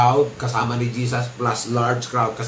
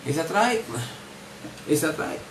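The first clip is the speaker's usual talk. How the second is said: ordinary delivery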